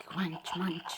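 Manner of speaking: breathy whispered munching noise; whispered crunch munch. Acted. Storyteller whispering the caterpillar's munching. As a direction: whisper the chewing sounds.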